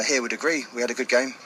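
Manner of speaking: usual speaking voice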